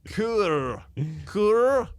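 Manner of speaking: high, drawn-out Scottish "cure"; exaggerated Scottish accent. Shown as a demonstration. Podcaster overworking one word, exaggerated Scottish accent. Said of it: "Scottish accent".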